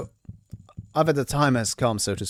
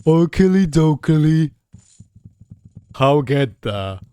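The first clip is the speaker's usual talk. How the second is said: dopey cartoon bellow; 2 semitones higher, 6 dB louder than usual. Player voices dim-witted dialogue choice.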